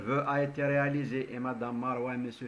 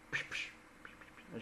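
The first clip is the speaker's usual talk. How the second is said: soft psh of a spray bottle; quiet spray-bottle sound effect; spray hiss; 10 dB quieter, faster than usual. Retold or imitated. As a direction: mimic a disinfectant spray. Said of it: "il fait ça à son bodyguard".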